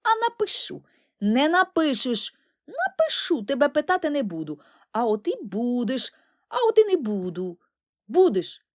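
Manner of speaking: high, loud squabbling; childish tit-for-tat quarrel; two brothers bickering; bickering twins. Acted. Twins bickering back and forth.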